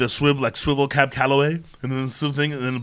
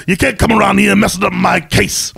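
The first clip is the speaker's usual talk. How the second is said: high, loud, hammed-up cop; mock noir police chief; territorial police chief; 9 semitones higher, 4 dB louder than usual. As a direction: warn off a private eye. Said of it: "And I'm the police chief".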